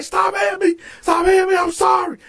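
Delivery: high-pitched mock pleading; panicked begging. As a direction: imitate a man begging mid-beating. Cellmate begs during beating.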